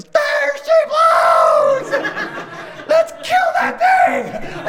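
all-out high shout, words drawn out; whaler's yell. Acted. Son sighting the whale's mist on the ocean, huge drawn-out yell. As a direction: bellow it like a lookout sighting a whale. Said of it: "all the kids are yelling".

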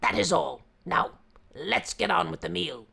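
impatient hungry tiger; tiger growl. Acted. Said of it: "The tiger roared".

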